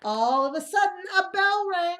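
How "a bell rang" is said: ordinary delivery, nothing marked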